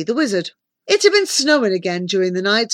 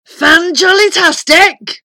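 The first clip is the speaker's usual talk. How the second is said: slow, high, jubilant shout; booming giant; 9 semitones higher, 9 dB louder, slower than usual. A narrator's reading. Giant wizard suggests praise for his teapot, booming. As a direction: bellow it syllable by syllable.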